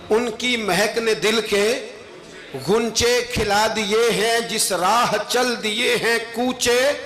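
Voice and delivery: slow, drawn-out poetry declamation; devotional verse recitation. Acted. Preacher recites praise verse to the congregation, slow declamation. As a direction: stretch each phrase of the couplet.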